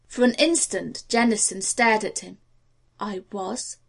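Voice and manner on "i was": quiet, soft I was; a soft, held-back I was; quietly. A narrator's reading. Jennison caught sailing, answering quietly. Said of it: "he said quietly".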